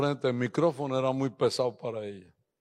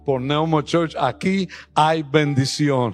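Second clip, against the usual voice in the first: building declaration; 9 dB louder than usual. Acted. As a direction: grow into a bold faith declaration. Declaration building to full preaching. (declaración que crece hasta predicar fuerte)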